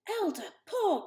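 high, loud alarmed cry; cried out. A narrator's reading. Mother crying out at the elder, high and loud. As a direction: protest in shock. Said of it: "cried Mother".

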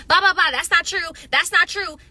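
loud, high blah-blah interruption; mock argumentative shouting. Imitating people who refuse to listen, mock argumentative shouting. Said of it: "It's like everyone has to be".

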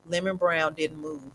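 ordinary delivery

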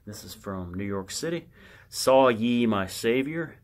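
normal talking voice

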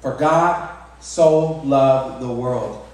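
preacher's meditative repeat. Shown as a demonstration. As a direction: let each word of "For God so loved the world" land. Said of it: "Think about that".

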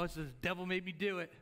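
normal talking voice

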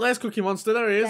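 loud, high hype call as the wrestler appears; excited call. Real, not acted. Friend hyping the wrestler walking out, loud excited call. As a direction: hype him up like a ring announcer. Greeting the wrestler entering ring.

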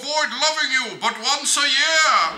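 hammed-up puppet Santa voice. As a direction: play a silly hand-puppet Santa.

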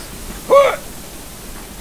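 high breathy mock gasp; dramatic gasp.

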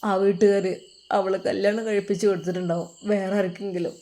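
lightly acted, subdued young man; halting and hopeless, with long pauses; heartbroken son. Son telling father she is lost to him, heartbroken.

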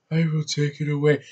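hammy, affected villain murmur impression; mock hammy actor voice, held back; Eddie Redmayne. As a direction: overact it as a restrained villain. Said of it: "this is how he talks in half the movie".

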